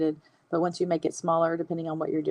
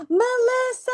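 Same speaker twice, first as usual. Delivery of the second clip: excited surprise; 4 dB louder, slower than usual. Real, not acted. Host announcing the giveaway winner, excited cry. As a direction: gasp then a high, loud cry of Melissa!.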